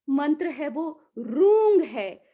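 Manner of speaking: normal talking voice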